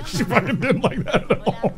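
laughing. Real, not acted. Player laughs about the annoyed opponent, laughing. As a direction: talk through real laughter.

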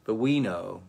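usual speaking voice

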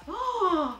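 high "oh!" of wonder; delighted gasp. Real, not acted. Reacting to eyebrows being made up, delighted gasp.

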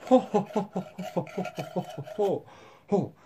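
soft mock-villain ho-ho-ho; staged gloating chuckle. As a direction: chuckle softly in pretend gloating, fading away. Gloats while cutting up giraffe.

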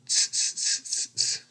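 soft voiceless "tss" sounds; monkeys' tss-ing. A narrator's reading. Monkeys copying the shouting peddler, soft tss-ing. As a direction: hiss back softly in little bursts. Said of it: "and said".

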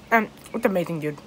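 talking while chewing; eating between words; mouth partly full. Real, not acted. Seen 0: fork bite at -7, hand at mouth, chewing motions.

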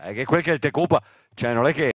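ordinary talk, nothing marked

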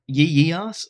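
"yee yee ass?" rising in disbelief; incredulous question. Real, not acted. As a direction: ask "yee yee ass?" back, slow and baffled, rising at the end. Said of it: "is this giving yee yee ass hair vibes?".

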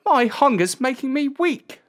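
put-on high-pitched boy's complaint; hungry shepherd boy. A narrator's reading. Boy tells his dogs he is hungry, high plaintive voice. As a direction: complain as a famished young lad.

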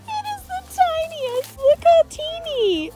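high, loud, giggly squeal; laughing delight. Real, not acted. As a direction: marvel at something tiny through laughter. Delighted by a tiny apple.

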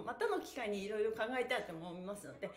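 ordinary talk, nothing marked

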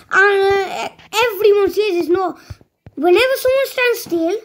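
loud, high-pitched protest; high, loud, aggrieved outburst; worked-up complaint. Real, not acted. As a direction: protest loudly, pitch climbing as you go. Complaining about their aim.

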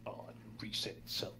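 quick whispered mutter to himself; whispered aside. Real, not acted. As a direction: breathe it out under your breath. Muttered aside about radio.

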